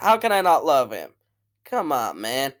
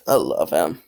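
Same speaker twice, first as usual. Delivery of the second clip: usual speaking voice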